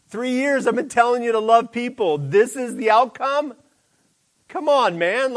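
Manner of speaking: high, loud, laughing exasperation at a friend; a teacher fed up with his student, half laughing; exasperated Jesus. Retold or imitated. Jesus scolding Peter after the ear is cut, exasperated and high. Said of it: "and Jesus is like".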